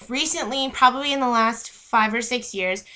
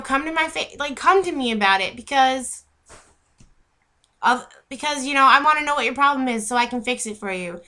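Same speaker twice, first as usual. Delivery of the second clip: angry comeback; 3 semitones higher than usual. Retold or imitated. Standing up to a bully, furious defiant yell. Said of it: "Just say".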